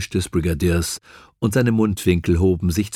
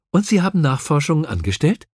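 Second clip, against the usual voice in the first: lightly chirpy, higher-pitched question; cheerful; 8 semitones higher, faster than usual. A narrator's reading. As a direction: ask it brightly, pitch lifted. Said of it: "fragte Joe munter".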